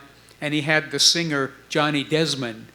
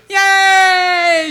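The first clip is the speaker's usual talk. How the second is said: loud high yay shout; cheering; 9 dB louder than usual. Retold or imitated. Band cheering the news they can sign, loud high shout. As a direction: cheer like you just got signed.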